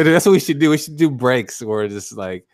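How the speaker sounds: ordinary talk, nothing marked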